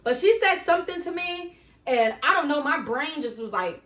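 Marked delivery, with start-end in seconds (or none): none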